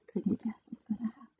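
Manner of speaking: quick, hushed whisper; whispered aside. Real, not acted. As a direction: whisper it fast, like a secret to the listener. Reader's whispered aside to listeners.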